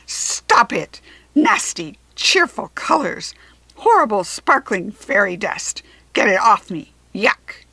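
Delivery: loud, disgusted ranting; furious shouting. A narrator's reading. Jack Frost shouts as fairy dust covers him. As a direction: yell and flail, revolted. Dust in his face, raging.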